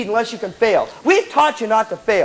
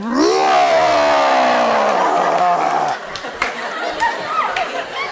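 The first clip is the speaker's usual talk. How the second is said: long low roaring rumble; imitated stomach monster growl; drawn-out comic roar; growing macaroni noise. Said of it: "the macaroni's going like".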